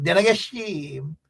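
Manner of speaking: Guyanese country twang, last word falling away; Guyanese Corentyne twang. Shown as a demonstration. Repeating the saying after translating it, Guyanese twang. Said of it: "as we would say in Guyanese quarantine twang".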